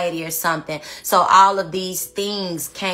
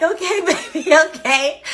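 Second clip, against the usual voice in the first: laughing; 9 semitones higher, 4 dB louder than usual. Retold or imitated. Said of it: "I'm like".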